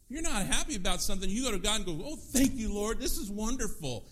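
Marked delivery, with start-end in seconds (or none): 2.0-4.1 higher, louder, over-bright mock thanks; fake gratitude. Praying to God while unhappy, with mock fake cheer. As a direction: gush insincerely, pretending to be pleased. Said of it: "you're not gonna fake God out".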